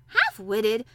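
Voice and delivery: loud indignant question; sharp, affronted outburst; angry. A narrator's reading. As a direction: throw the word back, offended. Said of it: "said Colin angrily".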